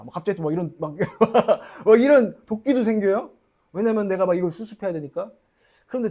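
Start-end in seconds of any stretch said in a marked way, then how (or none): 1.0-1.8 a quick embarrassed laugh at himself; short laugh. Real, not acted. Pastor laughing at his own stubborn resolve. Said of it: "laughs at himself". 1.8-3.3 said through a laugh, louder. Real, not acted. Pastor confessing his stubbornness, laughing.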